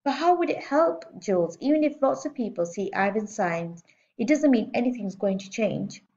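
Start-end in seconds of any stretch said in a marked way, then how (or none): 0.0-1.1 much higher questioning; a weary doubtful dad; father questioning daughter. A narrator's reading.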